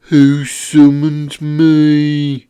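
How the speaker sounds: very loud, slow creature bellow. Acted. Monkey Toad confronts the summoners, bellowing. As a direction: bellow it out, stretching every word. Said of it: "bellowed the Monkey Toad".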